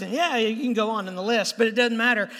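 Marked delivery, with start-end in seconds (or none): none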